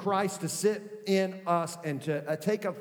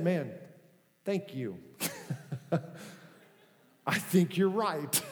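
laughing softly as she agrees; a grateful laugh through the words; wife laughing; 3 semitones lower, 3 dB quieter than usual. Retold or imitated. Preacher retells his wife thanking him, laughing. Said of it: "she just said".

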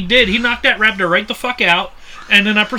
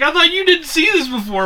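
mock incredulous; 7 semitones higher than usual. Imitates friend's incredulous accusation.

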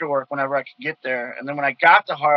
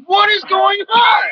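high, slow wailing disbelief; hammed-up whine of confusion; dragged-out high cry of mock despair; mock bewildered cry; 6 dB louder, slower than usual. Baffled outburst after being mocked.

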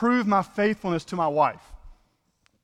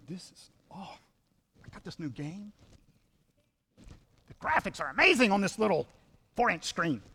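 absorbed gamer; 3 semitones higher, 3 dB quieter than usual. Acted. Preacher voicing phone-absorbed spectator.

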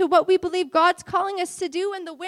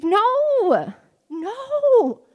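1 emphatic no; 6 semitones higher, 6 dB louder than usual. Real, not acted. Preacher rejects fear's lies.

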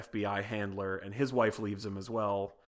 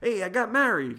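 much higher, louder cheery news; mock excited; 11 semitones higher, 6 dB louder than usual. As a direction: blurt it out proudly. Excited mobster calling handler.

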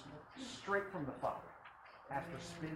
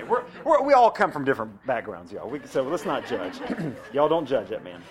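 preaching at full force; 14 dB louder than usual. Acted.

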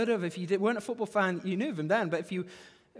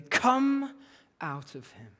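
stern command; 6 semitones lower, slower than usual. A narrator's reading. Jesus ordering the spirit out of the man, stern. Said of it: "Jesus said sternly".

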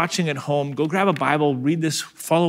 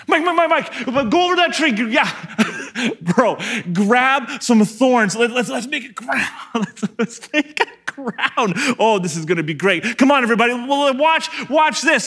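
much higher, giggling through the mockery; a cruel soldier cracking himself up; laughing jeering soldier; 6 semitones higher than usual. Soldiers making thorn crown.

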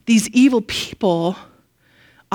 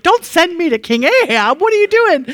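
rising, louder panicked pleading; alarmed protest; 8 semitones higher, 4 dB louder than usual. Acted. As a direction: beg not to be sent, voice climbing high. Obadiah dreads facing King Ahab.

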